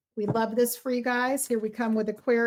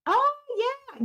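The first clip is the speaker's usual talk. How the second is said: a much higher squeal of recognition; light up at a familiar name; delighted oh. Real, not acted. Tarot reader greeting a viewer from New Zealand, delighted.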